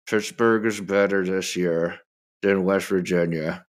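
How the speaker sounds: forced out through gritted teeth; grudging, teeth clenched. Acted. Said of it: "I have to say that with my teeth clenched".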